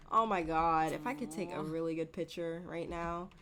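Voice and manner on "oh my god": usual speaking voice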